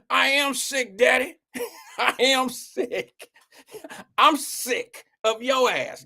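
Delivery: high, slow, laughing daughter voice; daughter's whiny reply. Retold or imitated. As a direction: whine it like a sulky teen. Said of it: "retold daughter's reply".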